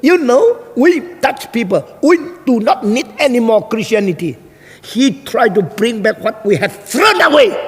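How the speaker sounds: high-pitched indignant tirade; very angry woman. Retold or imitated. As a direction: imitate an angry Dutch heckler ranting. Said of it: "Kenapa lu marah sekali sama orang?".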